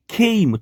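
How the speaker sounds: ખેઈમ with a long ei, as in came; slight y glide. Shown as a demonstration. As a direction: let a little y into ખેઈમ. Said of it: "થોડો યનો ઉચ્ચાર આવશે".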